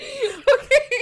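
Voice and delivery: loud high laughing through words; laughing hard. Real, not acted. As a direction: crack up while talking. Both crack up mid-letter.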